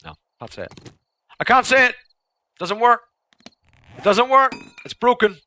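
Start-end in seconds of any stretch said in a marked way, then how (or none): 1.4-5.4 higher, louder exasperated cry; frustrated outburst. Real, not acted. Giving up on the tongue twister, loud and exasperated. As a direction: throw up your hands, it's broken.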